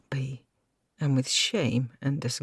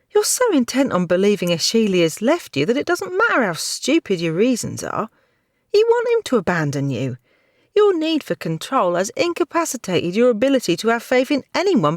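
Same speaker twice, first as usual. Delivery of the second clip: higher, louder bold rebuke; 7 semitones higher, 7 dB louder than usual. Acted. Maidservant boldly rebukes her knight, raised voice. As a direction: scold him with sudden courage. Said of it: "with a sudden boldness".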